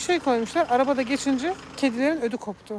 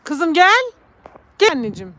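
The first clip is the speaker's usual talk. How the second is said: loud high "Kızım gel"; calling the cat; 6 semitones higher, 12 dB louder than usual. Real, not acted. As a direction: call her over fondly. (kediye yüksek sesle seslenme)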